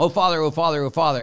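hint of needy parishioners calling the priest; imitating parishioners. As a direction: whine it like people always wanting something.

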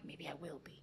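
half to herself, hoping to become Irene; hushed aside. Retold or imitated. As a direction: murmur it as a private hope. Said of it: "I'm like".

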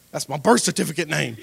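loud, hammed-up teenage annoyance. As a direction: object loudly in mock anger. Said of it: "that made me even more mad".

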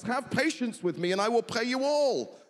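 pleading servant. Retold or imitated. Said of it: "what's ridiculous about this".

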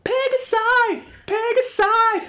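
very high mock cry; high-pitched correction. Co-host correcting a plural, high-pitched mock outcry. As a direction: call it out in a squeaky, insistent voice.

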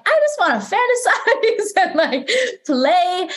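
high, laughing, playful self-quote; giggly daydreamer. Retold or imitated. Mocking her own Piscean side with a giggle. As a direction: giggle through it like a carefree dreamer.

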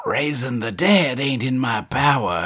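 put-on crone voice with drawn vowels; old witch impression. Old witch answering Lance, put-on character voice. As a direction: play the bog witch refusing. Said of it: "and she's like".

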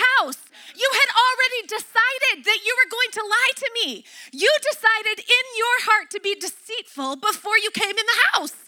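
high-pitched, indignant parent tirade, retold; acted outraged mom laying out the charge; angry confrontation. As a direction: scold in disbelief, pitch right up. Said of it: "I was so angry".

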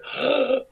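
sharp high gasp of amazement; a quick startled intake of breath; mock gasp. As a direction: gasp as if stunned by the news. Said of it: "Ray Donovan was like".

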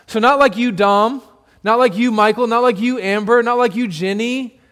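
normal talking voice

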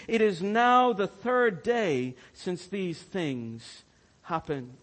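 loud, slowing scripture reading. Acted. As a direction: read it with weight. Reads disciples' reply aloud.

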